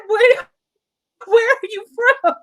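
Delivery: high, rising, amazed asking; eager curious question. Retold or imitated. Asking an upset colleague where he's from, high and eager. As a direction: lean in, dying to know.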